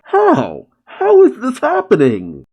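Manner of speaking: high-pitched, incredulous cry; shocked disbelief. Retold or imitated. Stunned fighter demanding to know how he is losing, bewildered. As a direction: voice leaping up in mock bewilderment.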